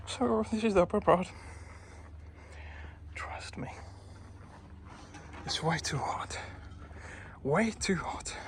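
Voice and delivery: breathy, winded half-whisper; out of breath. Real, not acted. Climber to viewers in the heat, out of breath. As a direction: puff the words out in the heat. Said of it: "I'm breathing heavy".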